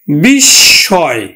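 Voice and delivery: বিস্ময় drawn out, loud, as "bishshoy"; বিস্ময় said loud and slow with a doubled s; slow pronunciation demo. Shown as a demonstration. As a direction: stretch বিস্ময় with a doubled s, "bish-shoy". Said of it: "দ্বিত্ব উচ্চারণ হয়".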